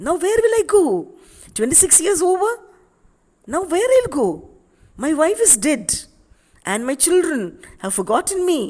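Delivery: high, loud, anguished cries; a broken man crying out where to go; anguished despair. Acted. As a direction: wail each question out in despair. Aksionov despairs over lost years.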